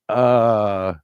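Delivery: normal talking voice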